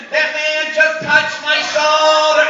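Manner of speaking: drawn-out high, loud scream; child screaming. Retold or imitated. Startled second grader accusing a stranger, screaming. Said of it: "And then she starts screaming".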